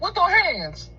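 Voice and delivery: high, mock fight-ready threat; tough worker. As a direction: dare him to swing first.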